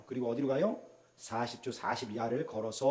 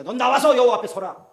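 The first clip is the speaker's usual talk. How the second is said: high, loud divine command; God commanding; 8 semitones higher, 12 dB louder than usual. Acted. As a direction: order him forward. (높고 크게 명령하듯이)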